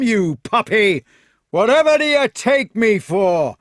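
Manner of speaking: loud, high, scornful rant; furious shouting. A narrator's reading. Fat knight refusing his foe a fair fight, shouting furiously. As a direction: bellow with outraged contempt.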